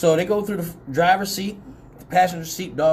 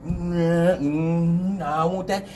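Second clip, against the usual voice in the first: softly grunted dog refusal; mumbled stubborn animal voice; 2 semitones lower, 3 dB quieter, slower than usual. Retold or imitated. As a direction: imitate a dog resisting with whiny hums. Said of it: "The dog like".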